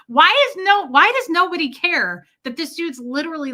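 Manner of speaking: high, loud disbelief; incredulous outcry. Retold or imitated. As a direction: cry out at nobody caring. Incredulous reaction to nobody caring.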